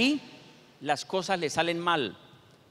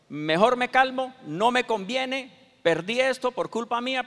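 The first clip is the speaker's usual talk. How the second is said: higher, louder, driving sermon; fiery preaching; 5 semitones higher, 5 dB louder than usual. Acted. Pastor hammering impatience to the church, loud fiery preaching. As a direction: press the point home to the congregation.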